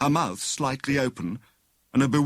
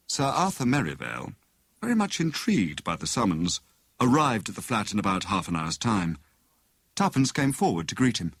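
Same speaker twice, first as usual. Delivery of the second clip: ordinary delivery, nothing marked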